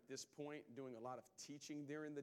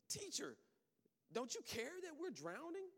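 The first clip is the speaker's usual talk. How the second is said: high, desperate cry for help; a frightened disciple begging; panicked plea; 13 semitones higher than usual. Acted. Disciples begging sleeping Jesus in storm, panicked high cry.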